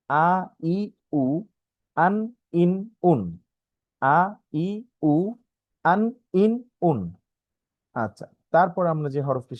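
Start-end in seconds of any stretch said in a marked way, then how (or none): 0.1-3.2 আ, ই, উ, আন, ইন, উন each said clearly and separately; vowel drill. Shown as a demonstration. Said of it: "তারপর ছিল বিভিন্ন উচ্চারণ". 4.0-7.0 আ, ই, উ, আন, ইন, উন said again, higher at the end; vowel drill repeated. Shown as a demonstration. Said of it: "তারপর ছিল বিভিন্ন উচ্চারণ".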